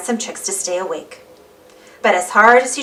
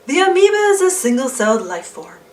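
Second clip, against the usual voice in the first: higher, lightly put-on documentary narrator; film narrator voice; 5 semitones higher than usual. The science film narrator explains amoebas to the class, lightly put-on documentary voice. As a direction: read it like an old school science film.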